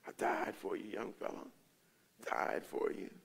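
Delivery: soft, breath-only murmur; low-volume, whispered assurance; hushed reassurance. Acted. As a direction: voice a gentle Jesus, almost whispering. Voicing Jesus interceding for him.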